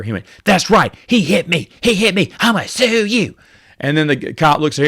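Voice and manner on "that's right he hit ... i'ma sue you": higher mock voice of an indignant driver; mocking the lady. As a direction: ham up the woman threatening to sue. Mimicking the lady to the cop.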